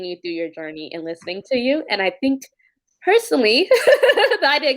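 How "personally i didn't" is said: emotional laugh. Real, not acted. Moved host laughing.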